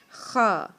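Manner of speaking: خا with a rough scrape at the back; gargle-like خا; Arabic khaa. Shown as a demonstration. Teacher shows the scraping خا sound. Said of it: "تو آپ لفظ ادا کریں گے".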